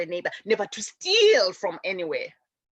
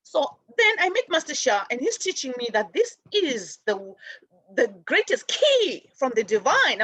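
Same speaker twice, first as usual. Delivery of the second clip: ordinary talk, nothing marked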